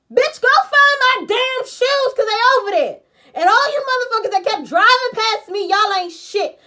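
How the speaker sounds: high, loud outraged rant; angry shouting. Real, not acted. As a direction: yell it at all the drivers who passed. Angry rant at uncaring drivers.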